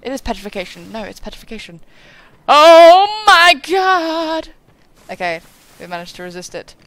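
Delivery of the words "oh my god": loud, drawn-out, high exclamation; loud, slow, high alarm at nearly dying; startled cry. Real, not acted. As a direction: stretch it out in real alarm. Near death in game.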